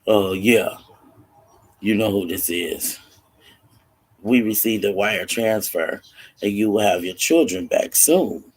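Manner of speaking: low, forceful kidnapper on the phone; menacing kidnapper. Retold or imitated. Kidnapper tells father the transfer arrived, menacing. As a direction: drop the pitch and speak with firm weight.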